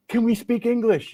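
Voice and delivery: high-pitched, hopeful request; imitated local's hopeful plea; pleading stranger. Imitating Russians switching to English.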